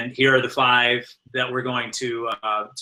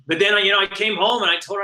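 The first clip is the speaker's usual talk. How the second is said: usual speaking voice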